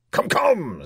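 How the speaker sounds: loud, high, impatient king. A narrator's reading. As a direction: hurry him along crossly as the king. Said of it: "said the king impatiently".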